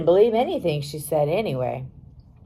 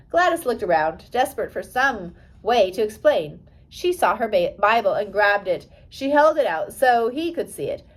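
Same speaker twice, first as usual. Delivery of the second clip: usual speaking voice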